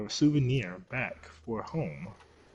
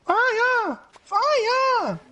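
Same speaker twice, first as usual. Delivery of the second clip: panicked shout; 9 dB louder than usual. Real, not acted. Character caught on fire.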